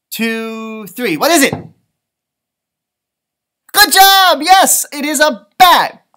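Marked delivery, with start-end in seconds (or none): 3.7-6.1 loud, high praise for the class; excited cheer. Real, not acted. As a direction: cheer them on loudly. Teacher cheers correct answer.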